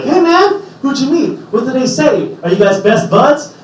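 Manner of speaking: high, excited questions; eager friends. Retold or imitated. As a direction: fire off questions like an eager fan. Friends back home eager.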